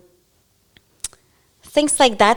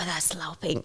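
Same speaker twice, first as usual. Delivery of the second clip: whispered try at a Scots phrase; whispered attempt; 5 semitones lower, 13 dB quieter than usual. Shown as a demonstration. Learner practising the host's Scottish phrase in a whisper. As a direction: whisper it, low and hushed. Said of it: "I'll try to say it".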